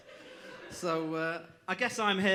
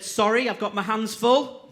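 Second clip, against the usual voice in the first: slow, much louder mock politician excuse; hammed-up loud politician apology; mock Hancock, loud and slow; Matt Hancock; 6 semitones higher, 12 dB louder than usual. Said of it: "But he said".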